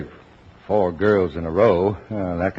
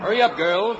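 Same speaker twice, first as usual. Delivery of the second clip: calling out; 12 semitones higher than usual. Acted. Dad calls daughters to swim.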